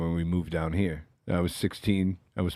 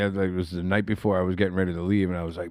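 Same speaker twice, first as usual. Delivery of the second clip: ordinary delivery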